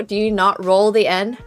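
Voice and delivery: ordinary delivery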